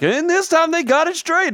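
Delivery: high, slow announcer call. Retold or imitated. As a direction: mimic a TV race announcer. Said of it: "the announcer's like".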